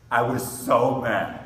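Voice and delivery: "I was so mad" drawn out with force; emphatic angry declaration; loud, slow, angry. Real, not acted. Preacher recounting his anger.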